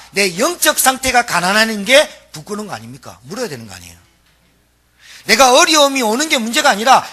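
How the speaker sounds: loud, high, driving sermon voice; preaching at full force. Acted. Preacher challenging the congregation on their spiritual poverty, full-force preaching.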